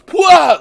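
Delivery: explosive mock blast noise; force push blast. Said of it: "he just goes".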